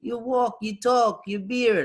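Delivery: high, slow, mock Egyptian guide voice; imitating the guide explaining how he could tell; tour guide. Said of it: "He goes".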